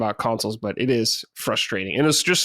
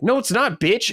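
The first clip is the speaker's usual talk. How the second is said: mock angry retort; 9 semitones higher than usual. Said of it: "I'm like".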